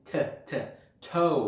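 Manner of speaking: bare "t t" puffs, then "toe" with a full O glide; consonant plus O. Shown as a demonstration. Teacher adding a letter to the O, sounded-out blend. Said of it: "เราก็ลองใส่ตัวอักษรเข้าไป อย่างเช่น".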